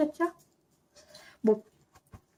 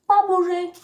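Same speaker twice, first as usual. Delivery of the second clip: louder and higher, firm stay order; loud command; 6 semitones higher, 7 dB louder than usual. Real, not acted. Telling the dog to stay a third time, loud and firm. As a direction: raise the voice so the dog obeys.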